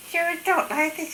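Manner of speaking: tiny high-pitched voice; mimsy little friend. Retold or imitated. Showing how grandmother's little friend talked, high mimsy voice. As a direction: imitate a small mimsy woman, way up high. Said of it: "this little mimsy friend about this size".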